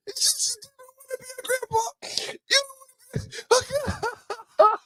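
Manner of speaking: high, fast fake sobbing; hammed-up blubbering; mock sobbing. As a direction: rush it out choked up. Fake-sob rant, fast.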